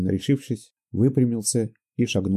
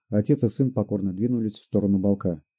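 plain reading voice

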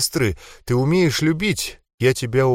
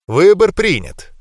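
slow, loud, high ceremonial declaration; solemn announcement; 6 semitones higher, 5 dB louder, slower than usual. A narrator's reading. Mentalist announcing the boy's choice, solemn proclamation. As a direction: proclaim it like a verdict. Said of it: "торжественно объявил менталист".

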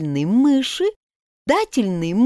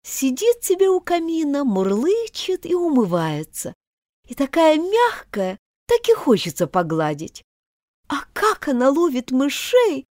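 put-on a bit higher, pleading young girl; gently coax, a touch girlish; a hint of a polite little girl pleading; lightly voiced girl Alice; 7 semitones higher than usual. Alice voice continues.

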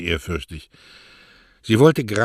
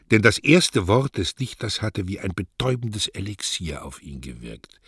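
usual speaking voice

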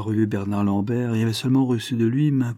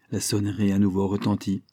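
usual speaking voice

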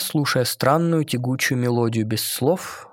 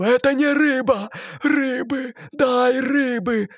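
high, whining plea, slow at the end; whiny cry; 12 semitones higher, slower than usual. A narrator's reading. Mermaid whining that cooked fish is not fish. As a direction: whine like a spoilt child. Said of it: "закричала она плаксиво".

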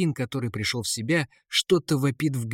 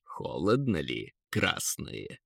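low, soft taunting eagle; snide; 7 semitones lower, 6 dB quieter, slower than usual. A narrator's reading. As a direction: tease them quietly, deep in the voice. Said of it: "ехидно спросил Эрвин". (ехидно, низким голосом)

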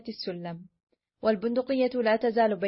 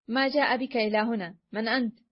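ordinary delivery, nothing marked

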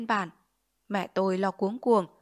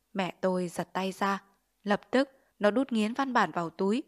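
ordinary delivery

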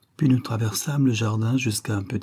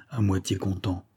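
ordinary delivery

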